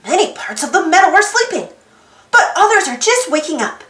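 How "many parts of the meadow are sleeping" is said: ordinary delivery, nothing marked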